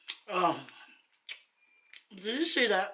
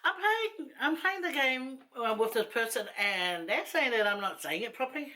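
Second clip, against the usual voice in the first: daughter's voice; 6 semitones higher than usual. Retold or imitated. Daughter telling mum about a game, imitated higher voice. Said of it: "She goes, "Mum".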